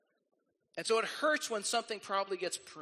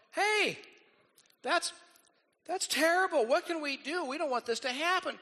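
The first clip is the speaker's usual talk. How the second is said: excited disciples; 8 semitones higher, 3 dB louder than usual. Retold or imitated. Disciples voiced in retold scene.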